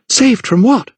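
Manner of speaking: high, tense demand; alarmed questioning. A narrator's reading. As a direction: challenge in frightened disbelief. Frightened Lydia questions the vicar.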